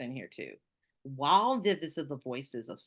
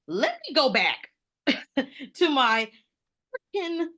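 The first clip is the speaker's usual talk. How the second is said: higher and louder, half-laughing determination; mock resolve; 8 semitones higher, 7 dB louder than usual. Retelling deciding to move back South, in playful loud resolve. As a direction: announce the return home playfully. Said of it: "So I was like".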